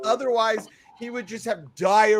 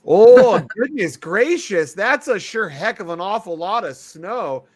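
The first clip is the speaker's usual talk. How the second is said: hammed-up Minnesota accent, loud opening exclamation; folksy Wisconsin-Minnesota amazement; Upper Midwest accent; 8 dB louder than usual. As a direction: put on a broad Minnesotan accent. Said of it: "talk like we're from Wisconsin or Minnesota".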